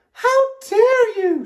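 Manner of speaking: very high, slow, outraged shout; angry shout. A narrator's reading. Soaked Miss Alma at her gifts flying, shouting angrily. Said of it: "she shouted".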